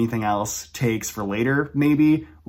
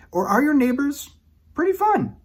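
cheery, sing-song. Pretend. Contrasting grim and happy neighbors.

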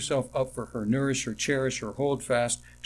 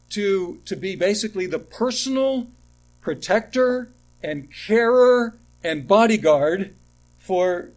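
raised, loud pulpit preaching, high and pressing; preaching at full force; 6 semitones higher, 6 dB louder than usual. Acted. Pastor to his congregation on fathers, preaching at full force. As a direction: drive it home to the pews like a fiery pastor.

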